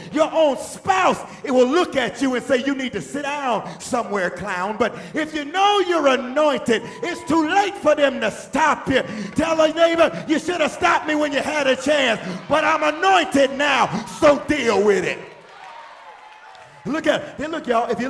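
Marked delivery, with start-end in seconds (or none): 0.1-4.8 full-force preaching. Real, not acted. Preacher rousing the congregation about their own spouse, full-force preaching. 4.8-15.2 high, loud preacher delivery; full-force preaching. Real, not acted. Preacher rousing the congregation about their own spouse, full-force preaching.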